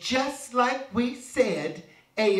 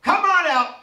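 higher and much louder call; yelled; 7 semitones higher, 7 dB louder than usual. A narrator's reading. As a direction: shout it at the house. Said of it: "old Joe yelled out".

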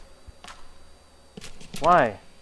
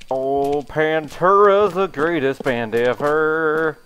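ordinary talk

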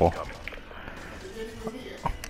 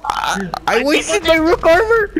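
much higher, slow, loud dismay; panicked wail; 5 dB louder, slower than usual. Real, not acted. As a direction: wail over the wasted armor. Panicked gaming moment.

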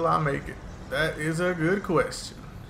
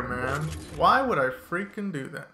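ordinary delivery

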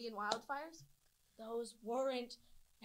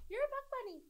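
high, teasing pitched-up quote; pitched-up mimicry of a teaser; mock accusation. Mimicking friends who call her a puck bunny, mock accusation. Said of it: "if any of you guys say".

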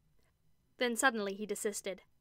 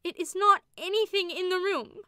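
frightened; 10 semitones higher, 8 dB louder than usual. A narrator's reading. Rebecca in horror.

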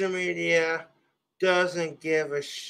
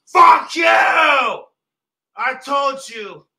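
much higher, much louder, cracking screams; screaming rage; 6 semitones higher, 7 dB louder than usual. Real, not acted. Streamer screaming at a bottle that won't stay on the desk. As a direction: scream at it, losing control.

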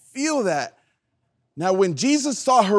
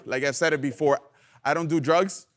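ordinary talk, nothing marked

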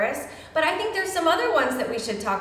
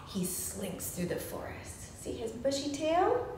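sneaky whisper; 12 dB quieter than usual. Pretend. Teacher whispering to kids about the slinking fox. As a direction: breathe it like a secret. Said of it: "describing sneaky fox".

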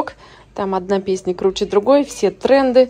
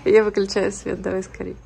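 quiet bedtime voice; 10 dB quieter than usual. Real, not acted. Putting the kids to bed.

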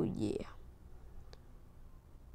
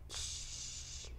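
soft unvoiced shush; quiet the baby down; hushing; 18 dB quieter than usual. Gamer hushes the crying baby with a shush.

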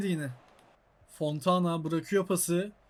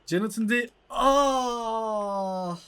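excited cry; much slower than usual. Real, not acted. Near miss in football game.

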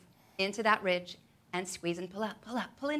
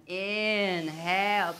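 drawn-out cue; much slower than usual. Acted. Long drawn inhale cue.

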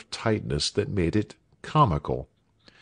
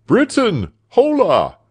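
loud, high-pitched hail; insistent calling, pitched right up; 13 semitones higher, 7 dB louder than usual. A narrator's reading. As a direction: shout to summon someone from the house. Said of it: "cried the doctor".

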